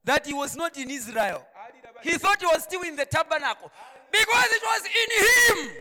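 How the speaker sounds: loud, high-pitched sermon delivery; full-force preaching. Acted. Preacher telling the congregation why Joshua didn't worry, full-force preaching.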